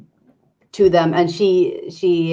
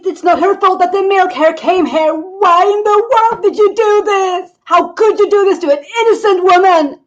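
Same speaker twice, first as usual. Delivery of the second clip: high, loud furious screaming; screaming in rage; 7 dB louder than usual. Acted. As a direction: scream at the villagers who burned her. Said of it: "starts screaming at them".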